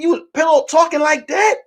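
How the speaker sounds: high, rising disbelief; incredulous accusation. Retold or imitated. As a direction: question a friend in retold indignation. First take, confronting friend.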